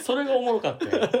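normal talking voice

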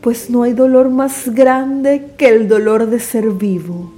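grand poetry declamation. Acted. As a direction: declaim with full dramatic force. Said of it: "declamaré para ustedes el poema".

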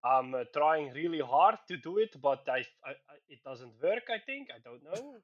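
effortful put-on Dutch accent; hammed-up Dutch English with hesitant fillers. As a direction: strain to keep up a fake Dutch accent. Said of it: "effort goes into talking like this".

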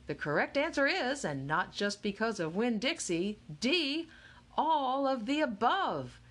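rising, triumphant announcement; hammed-up quiz host reveal; showman's reveal. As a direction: build up to the big answer. Game show host reveal.

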